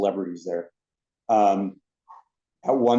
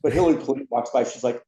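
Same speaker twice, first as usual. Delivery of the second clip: usual speaking voice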